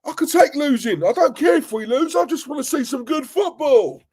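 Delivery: mock radio caller. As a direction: play a preachy phone-in fan. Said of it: "Spurs fans ring up".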